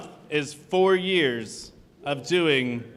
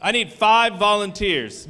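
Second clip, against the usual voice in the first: usual speaking voice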